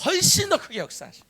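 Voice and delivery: 훨씬 stretched high and loud; repeat-after-me emphasis. Shown as a demonstration. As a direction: shout 훨씬 더 크게 high for all to echo. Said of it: "다시". (훨씬을 높고 크게 강조해 선창)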